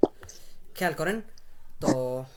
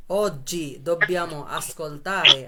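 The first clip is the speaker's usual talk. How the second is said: very slow, louder teaching delivery; slow modelling; 5 dB louder, much slower than usual. Shown as a demonstration. Teacher tells class they must listen today, slow modelling. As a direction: spell the phrase out slowly for learners.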